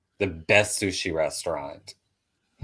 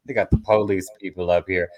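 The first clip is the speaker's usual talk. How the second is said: ordinary talk, nothing marked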